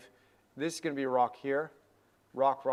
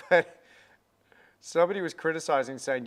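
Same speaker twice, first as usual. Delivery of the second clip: usual speaking voice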